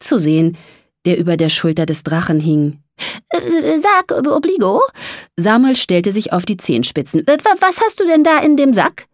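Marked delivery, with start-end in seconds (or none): 3.3-4.8 high, faltering voice; hesitant mole. A narrator's reading. Mole broaches the sack with the dragon, hesitantly. 7.3-9.1 high-pitched, stuttering question; stammering mole. A narrator's reading. Mole asks dragon what is in the sack, stammering.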